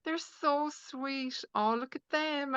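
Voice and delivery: high, slow doting coo over a sweet couple. Retold or imitated. A person watching an affectionate couple: a high, slow doting coo. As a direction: melt at the sight of them, aww. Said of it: "the person that'll be like".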